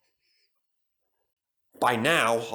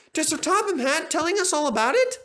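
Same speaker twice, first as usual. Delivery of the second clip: severe. A narrator's reading. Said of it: "Percy went on severely".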